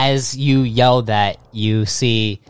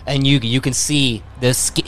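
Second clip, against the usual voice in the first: ordinary talk, nothing marked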